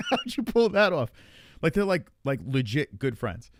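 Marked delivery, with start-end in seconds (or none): 0.1-1.1 high, loud incredulous question; amused disbelief. Retold or imitated. Asking the friend after finding out, amused disbelief. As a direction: exclaim it in retold amazement. Retells astonishment, just after laughing.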